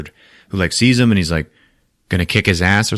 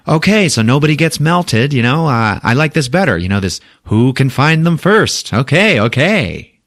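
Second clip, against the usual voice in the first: high, louder showman voice; hammed-up ruler settling a dispute; Grand Master; 7 semitones higher, 4 dB louder than usual.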